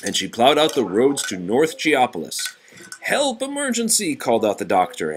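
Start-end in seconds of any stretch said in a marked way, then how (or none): 3.0-4.2 high urgent plea for help; urgent call. Acted. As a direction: cry out for an emergency. Doctor calls for help.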